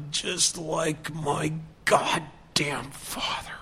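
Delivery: put-on slow, broken whisper full of old pain. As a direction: breathe it out like it hurts to say. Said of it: "there was a lot of pain in this voice".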